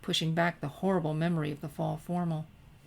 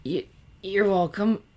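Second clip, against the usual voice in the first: stammering; 3 semitones higher, 5 dB louder than usual. A narrator's reading. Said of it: "she stammered".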